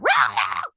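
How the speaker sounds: short loud roaring yell, no words; mock yelling noise. Wife showing how she fights with someone, wordless mock yell. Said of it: "I can't fight with someone like a".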